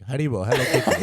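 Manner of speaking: "Haribo" as HAH-dee-bo in a mock Japanese accent; playful mock Japanese accent, "Hello Kitty" rising. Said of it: "This is like".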